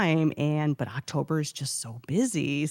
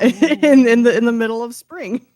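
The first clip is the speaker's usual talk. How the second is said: laughing; 5 semitones higher, 8 dB louder than usual. Real, not acted. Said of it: "laughing through line".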